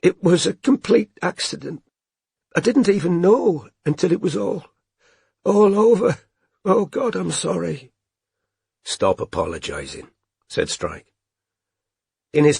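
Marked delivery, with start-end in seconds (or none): 0.0-7.9 high, tearful squeaky voice. A narrator's reading. Robin to Strike, tearful and high-pitched. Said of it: "her voice halfway between a squeak and a moan". 8.8-10.1 gentle. A narrator's reading. Strike gently comforting crying Robin.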